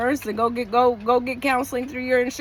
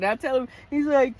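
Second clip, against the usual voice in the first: normal talking voice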